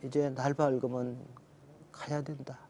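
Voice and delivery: tender farewell. Retold or imitated. Father talking to dead baby.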